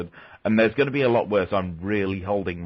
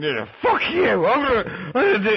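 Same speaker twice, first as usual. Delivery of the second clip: put-on drunk gamer rant; 13 semitones higher than usual. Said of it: "just kinda going".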